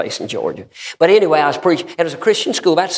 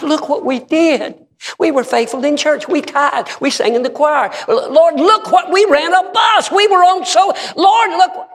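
high, loud, slow whining plea; pleading before God; 14 semitones higher, 4 dB louder than usual. Acted. False believers pleading with God, high whining shout. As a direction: beg the Lord to look. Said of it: "a lot of people stand before God".

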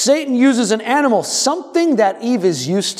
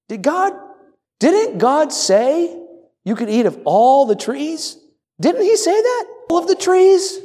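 high, sly, wheedling tempter voice, much higher; sly serpent; 7 semitones higher than usual. Acted. Preacher playing the serpent tempting Eve, sly and high. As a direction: coax like a snake planting doubt.

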